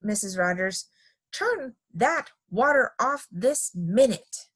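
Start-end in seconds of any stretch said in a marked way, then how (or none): 1.3-4.5 slow, high, word-by-word command; shouted order. A narrator's reading. Missus Rogers orders the hose off, slow high scolding. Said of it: "shouted Miss".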